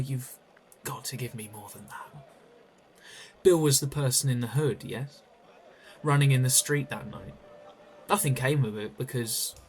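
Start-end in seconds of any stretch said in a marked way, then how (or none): 3.4-9.6 loud pressing accusation; louder, cornering her; accusing. Acted. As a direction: hammer each point at her.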